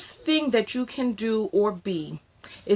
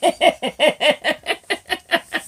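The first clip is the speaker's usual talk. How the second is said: high, sustained fit of laughter; helpless laughter; 5 semitones higher than usual. Real, not acted. Vlogger laughs at the botched accent, helpless giggling fit. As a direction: laugh on and on at your own slip.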